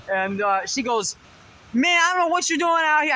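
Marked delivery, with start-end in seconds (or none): 1.7-3.1 high, loud imitation of a Bronx accent. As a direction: play the Bronx woman in line with a rough Bronx accent. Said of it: "she got that badass accent".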